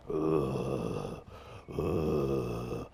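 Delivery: deep, creepy raspy breaths; demon breathing. Retold or imitated. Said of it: "breathes like".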